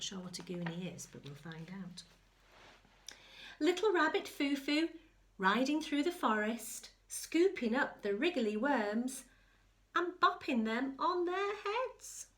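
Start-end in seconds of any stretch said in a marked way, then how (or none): 3.6-12.3 lively, rising read-aloud that climbs higher and louder; sing-song picture-book telling; storybook narration. Acted. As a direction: build the excitement for a child.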